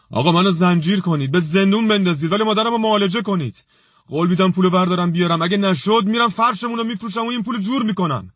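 trembling desperate shout. A narrator's reading. Ali begs the doctor for his mother, a high shaking shout. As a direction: shout it out, begging on the edge of breaking. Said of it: "با صدای لرزانی فریاد زد".

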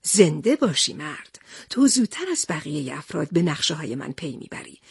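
low, knowing praise with a smile. A narrator's reading. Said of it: "سندباد لبخند معنیداری زد و گفت".

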